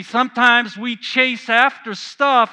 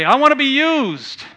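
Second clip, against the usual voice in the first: eager declaration; 6 semitones higher, 5 dB louder than usual. Retold or imitated. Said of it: "we always say".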